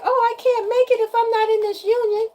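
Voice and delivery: high-pitched mock-needy voice; mocking helpless quote. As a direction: act out a helpless, clingy plea in a high voice. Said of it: "was gonna be like".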